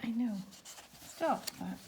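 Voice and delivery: ordinary delivery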